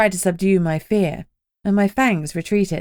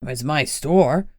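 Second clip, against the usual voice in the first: slow, low, sulky store owner; grumbling it's mine, low and slow; bitching; 4 semitones lower, much slower than usual. Acted. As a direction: drag it out like a put-out old man. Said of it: "Lenny bitched".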